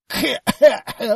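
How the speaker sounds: hacking choked cough; harsh cough. Acted. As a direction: cough hard mid-sentence. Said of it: "кашляя".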